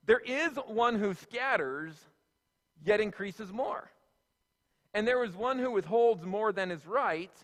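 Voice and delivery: slow, measured Bible reading; scripture reading. Acted. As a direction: read each clause deliberately, with pauses. Reads scripture aloud.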